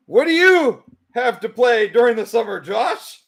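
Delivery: high, loud, drawn-up call; exaggerated loud question to a co-host. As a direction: project it high across the room. Shouting for the one mic to pick up.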